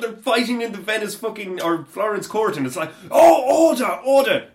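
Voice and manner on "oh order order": high, loud hammed-up shouting; mock call for order. Acting out a Florence court scene, mock shouting for order. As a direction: holler over an imagined rowdy court.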